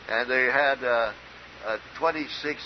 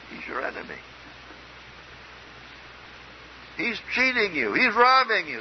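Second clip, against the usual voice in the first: voicing the tempting lie; 9 semitones higher than usual. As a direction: press the accusation on the listener. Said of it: "That's what was said, implied, inferred".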